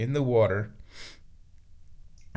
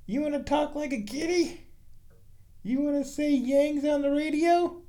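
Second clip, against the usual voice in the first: much higher, sing-song pet talk; 14 semitones higher than usual. Real, not acted. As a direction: coo up high to the cat. Baby talk to the cat.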